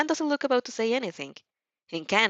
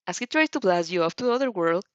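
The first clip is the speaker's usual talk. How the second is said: ordinary delivery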